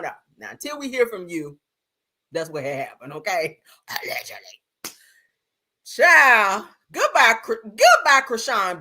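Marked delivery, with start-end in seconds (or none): 3.9-4.9 breathy whispered aside, much softer and lower. Real, not acted. Streamer covering herself to chat with a whispered aside. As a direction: murmur it like a sly disclaimer. Adds a legal hedge aside.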